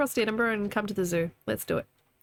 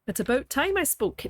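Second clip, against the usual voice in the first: higher Canadian attempt slipping into Scottish; attempted Canadian accent; 6 semitones higher than usual. Shown as a demonstration. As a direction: try Canadian, let it drift Scottish. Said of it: "I'm going Scottish now".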